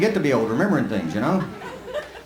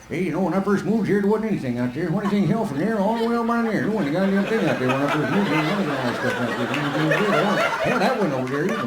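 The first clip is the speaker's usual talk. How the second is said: put-on higher, rambling old-timer voice; old granddad rambling; 3 semitones higher than usual. Granddad in the passenger seat reminiscing, old-timer ramble. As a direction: grumble on like a grandpa who won't stop. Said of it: "he's gonna be over on the passenger side over there going".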